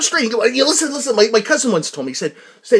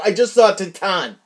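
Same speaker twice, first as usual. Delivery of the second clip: ordinary talk, nothing marked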